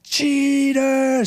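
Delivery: very high mock scream of "Cheater!"; high mock fan scream; shrill yell. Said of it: "But she was like".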